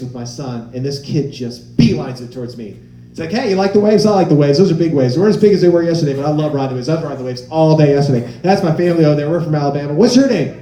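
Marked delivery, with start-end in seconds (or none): 3.3-10.5 put-on loud, breathless-sounding kid's ramble; over-chatty kid. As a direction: gush at a stranger like an eager child.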